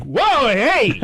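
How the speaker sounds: high mock yelp of alarm; alarmed tough guy. Tough passengers as plane drops, mock alarmed cry. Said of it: "most Texan tough guy passengers".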